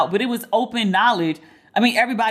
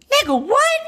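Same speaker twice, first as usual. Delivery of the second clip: leaps up into a squeaky high "what"; incredulous high squeal; stunned disbelief; 3 dB louder than usual. Real, not acted. Reacting to her friend's excuse, high-pitched disbelief.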